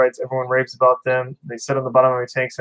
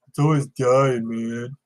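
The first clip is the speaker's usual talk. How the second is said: a hint of a dumb dude complaining; 3 dB quieter, slower than usual. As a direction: play it a bit dim and lazy. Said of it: "I use my dumb voice".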